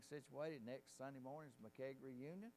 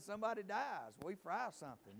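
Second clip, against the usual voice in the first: higher and louder, landing the joke; loud punchline; 8 semitones higher, 9 dB louder than usual. Retold or imitated. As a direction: punch it out for the laugh. Punchline repeated with more force.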